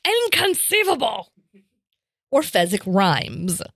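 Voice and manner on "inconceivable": Vizzini impression. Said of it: "Vizzini says".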